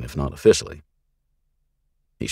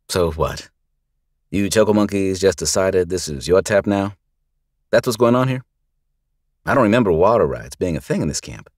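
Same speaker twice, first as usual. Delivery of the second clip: stern lawman; 5 semitones higher, 5 dB louder than usual. Acted. Lawman confronts the gang.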